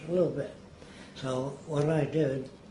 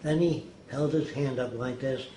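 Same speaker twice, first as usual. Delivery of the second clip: ordinary talk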